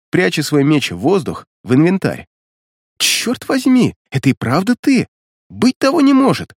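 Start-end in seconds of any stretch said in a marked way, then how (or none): 3.0-6.5 high-pitched, thrilled exclamation; amazed delight. A narrator's reading. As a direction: exclaim in joyful disbelief at a reunion. Woman delightedly recognises an old acquaintance. (радостное изумление)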